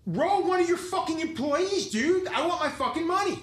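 arguing angrily. Retold or imitated. Tattooer argues with the shop owner for cash. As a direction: insist hard, fed up. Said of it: "Now I'm arguing".

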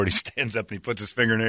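normal talking voice

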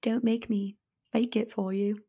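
soft, slow British accent, almost whispered. Shown as a demonstration. Podcast host coyly showing cohost a British accent. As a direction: put on a hushed British accent. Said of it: "in a British accent".

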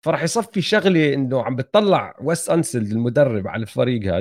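normal talking voice

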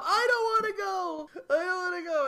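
hammed-up sobbing protest; mock crying. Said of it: "quit your fucking crying".

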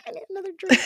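high laughing shout. Real, not acted. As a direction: burst out laughing while saying it. Laughing outburst before the letter.

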